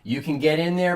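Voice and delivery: normal talking voice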